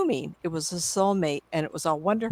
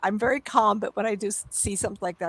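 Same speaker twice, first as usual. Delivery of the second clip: ordinary delivery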